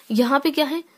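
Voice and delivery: ordinary delivery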